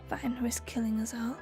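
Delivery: breathy whisper of a threat. Acted. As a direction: hiss it under the breath.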